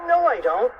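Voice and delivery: high, loud retort; emphatic contradiction. A narrator's reading. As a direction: fire back loudly and high. Contradicting friend in story.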